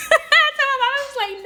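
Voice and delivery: high, breaking with laughter; cracking up mid-sentence; laughing hard. Real, not acted. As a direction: laugh through the words. Laughing at own story.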